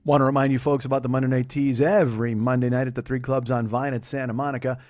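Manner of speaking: normal talking voice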